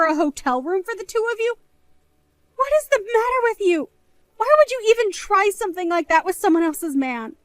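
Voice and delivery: high, indignant outburst; angry. Acted. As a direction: demand it, outraged. Sister confronts flirting sibling.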